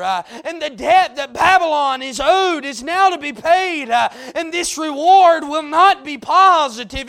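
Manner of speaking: loud, fervent preaching; fiery pulpit climax; preaching at full force. Real, not acted.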